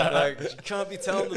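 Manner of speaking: ordinary talk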